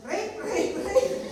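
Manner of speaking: high, loud mock scream of a false accuser. Preacher acting Potiphar's wife crying out falsely, high mock scream. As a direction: shriek it high as a play-acted cry for help. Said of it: "Bigla siyang sumigaw".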